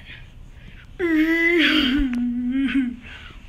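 high, wailing mock sob; hammed-up wailing cry in a far-off voice; crying noise. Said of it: "What's that crying noise?".

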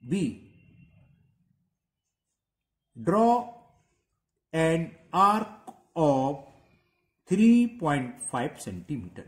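very slow dictation, words drawn apart. Shown as a demonstration. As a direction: spell out the step for copying. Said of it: "लिखो".

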